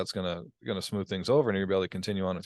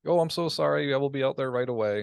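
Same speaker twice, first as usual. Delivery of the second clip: mock apologetic; 6 semitones higher than usual. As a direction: play a fawning service rep. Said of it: "that's completely opposite".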